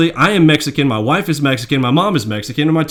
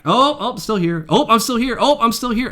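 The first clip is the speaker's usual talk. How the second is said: high, sing-song mockery; mocking whiny voice; 9 semitones higher than usual. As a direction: mimic a needy attention seeker. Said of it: "How many days did he come back?".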